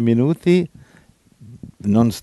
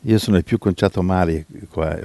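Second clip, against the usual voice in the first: ordinary talk, nothing marked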